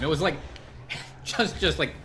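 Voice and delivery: ordinary talk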